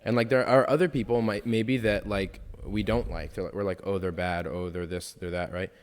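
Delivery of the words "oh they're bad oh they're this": usual speaking voice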